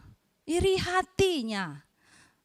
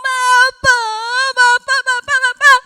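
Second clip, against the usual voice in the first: much higher, very loud wailing cries; 9 semitones higher, 14 dB louder than usual. Acted. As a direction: howl for your father in grief. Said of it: "meraung-raung".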